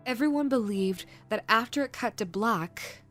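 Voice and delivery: ordinary delivery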